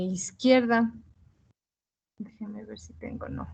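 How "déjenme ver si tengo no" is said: whispered aside to herself; whispering. Real, not acted. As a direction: drop to a quick whisper. Aside to herself while searching.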